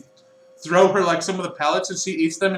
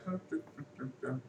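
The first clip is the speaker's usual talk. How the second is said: bored humming; 4 semitones lower, 19 dB quieter, faster than usual. Acted. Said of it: "she's just like".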